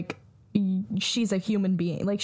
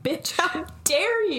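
high, slow, sassy offended voice; dog's offended voice; 7 semitones higher than usual. Retold or imitated. Friend voicing the insulted dog snapping at her owner. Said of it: "She was like".

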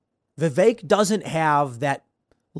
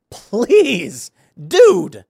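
loud, high, drawn-out begging; exasperated, pleading outburst; exasperated plea; 7 semitones higher, 8 dB louder than usual. Real, not acted. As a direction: beg him, stretched out and loud. Pleading with the absent candidate.

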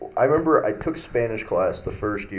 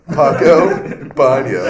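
Paco Baño louder, breaking into a laugh; cheeky loud Paco Baño; loud joking answer; 4 semitones higher, 10 dB louder, slower than usual. Retold or imitated. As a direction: blurt Paco Baño through a grin. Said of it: "And I'm like".

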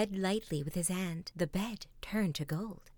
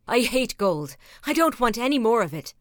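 loud, fast regretful king; crying out; 8 dB louder than usual. Acted. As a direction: blurt it out, sick of gold. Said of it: "cried the king".

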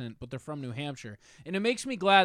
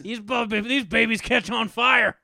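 louder, slower mock pitchman; hammed-up gun salesman; 6 semitones higher, 7 dB louder, slower than usual. Friends riffing on gun talk, hammed-up salesman voice. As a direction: sell the flaming rounds like an infomercial.

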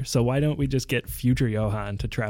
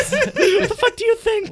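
high, loud, through laughter; laughing disbelief; 8 dB louder, slower than usual. Real, not acted. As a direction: ask it while cracking up.

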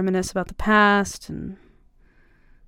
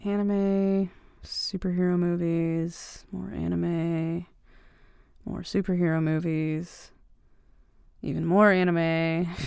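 usual speaking voice

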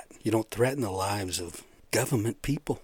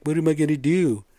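higher, louder, mock helpless plea; bewildered woman; 6 semitones higher, 5 dB louder than usual. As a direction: play her lost and pleading.

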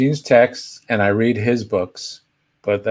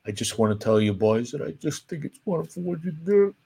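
softer, lightly choked-up imitation of a teary comedian; emotional Jerry Lewis; 3 semitones higher, 6 dB quieter than usual. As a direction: play it misty-eyed and soft, a hint of a sob. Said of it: "the emotional Jerry that Martin Short does".

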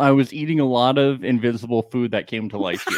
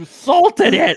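laughing, much higher; 5 dB louder, slower than usual. Real, not acted. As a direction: giggle out the words. Laughs at the mime.

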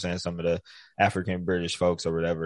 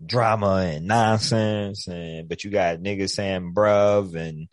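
ordinary talk

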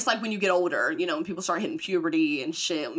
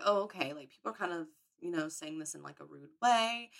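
much softer dawning thought; quiet realization; 3 semitones lower, 9 dB quieter than usual. Retold or imitated. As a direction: murmur it as it sinks in. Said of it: "realizing quietly".

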